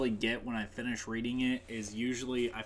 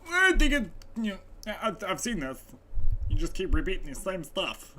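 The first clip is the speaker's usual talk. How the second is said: high, put-on mocking heckler voice; mock objection from a fed-up viewer; imagined complaining viewer; 8 semitones higher, 7 dB louder than usual. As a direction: mimic a whining critic, pitched up. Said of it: "they're gonna be like".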